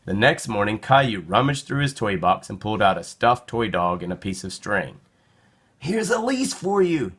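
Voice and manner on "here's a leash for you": put-on little boy voice. A narrator's reading. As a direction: talk to the toy like a little boy. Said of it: "he said".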